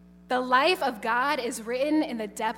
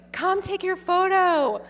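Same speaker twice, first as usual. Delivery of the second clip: mock call to passers-by, higher; 7 semitones higher, 4 dB louder than usual. Nun calling crowds to take photos, calling out. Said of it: "four hours yelling".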